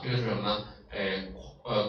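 usual speaking voice

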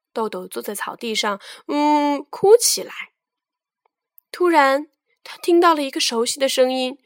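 ordinary delivery

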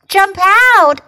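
high, loud shout of jump out; yelled. Acted. Duck and frog yell at the animals, shouting. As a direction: warn them out of the pool. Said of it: "yelled Duck and Frog".

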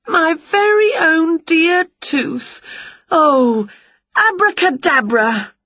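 slow, high, loud delighted; savouring every word; tender delight. A narrator's reading. Winnie holding her tooth, slow high delight.

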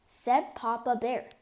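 ordinary delivery

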